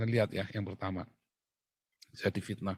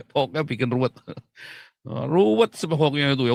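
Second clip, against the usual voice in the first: laughing repeat; 3 semitones higher, 5 dB louder than usual. Real, not acted.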